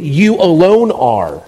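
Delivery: "You alone are" slowed, leaning hard on "You"; weighty, deliberate emphasis on "You" and "alone"; emphatic you. Shown as a demonstration. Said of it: "We call this the emphatic position".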